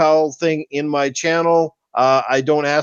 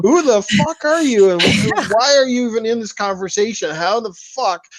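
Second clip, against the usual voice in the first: exasperated outburst; 5 semitones higher than usual. Retold or imitated. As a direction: snap at an uninvited stranger in your feed. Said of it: "and you're just like".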